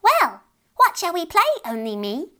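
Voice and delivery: high, bright little fairy voice, playful; a tiny fairy child teasing; laughing fairy child. Acted. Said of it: "laughed the fairy".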